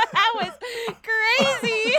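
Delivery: loud, high laughing exclamation; laughing hard. Real, not acted. Cracking up at the co-host, loud laughing delivery. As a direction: burst out through laughter.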